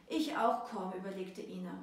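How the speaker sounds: ordinary delivery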